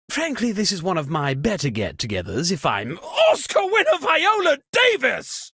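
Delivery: ordinary delivery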